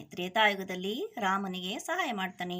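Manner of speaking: usual speaking voice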